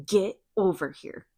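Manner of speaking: slow angry words through his teeth; angry husband orders his wife back, through his teeth. Retold or imitated. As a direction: grit it out like a furious husband. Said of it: "through his teeth".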